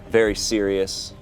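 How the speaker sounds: ordinary talk, nothing marked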